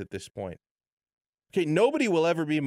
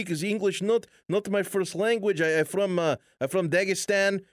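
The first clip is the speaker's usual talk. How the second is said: a light mock of a Russian fighter speaking halting English; Khabib's Russian accent; 5 semitones higher than usual. Radio host imitates the fighter's thick Russian accent. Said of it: "very thick Russian accent".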